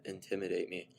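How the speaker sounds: ordinary delivery, nothing marked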